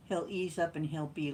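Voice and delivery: ordinary delivery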